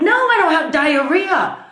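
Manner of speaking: mock the operator's question then snap back in disbelief, drawn out; frustrated retort. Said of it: "I'm getting so frustrated with this lady".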